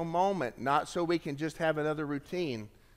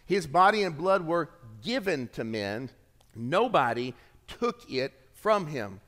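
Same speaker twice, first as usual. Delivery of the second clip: ordinary talk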